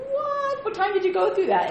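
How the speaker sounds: very high, loud astonishment; high-pitched acted disbelief; amazed. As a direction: squeal the question in exaggerated amazement. Acting amazed reply to friend's news.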